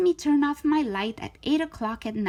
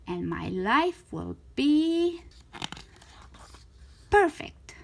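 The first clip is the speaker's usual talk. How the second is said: dreamy delight; 3 semitones higher, slower than usual. A narrator's reading. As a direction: savour the dream, then burst out with it. Imagining life without her parents.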